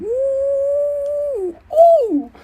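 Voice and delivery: very high drawn-out hooting; mock ooh. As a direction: hoot like a hype man. Imitating background hype.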